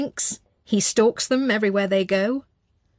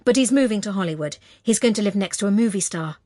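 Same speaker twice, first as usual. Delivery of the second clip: plain reading voice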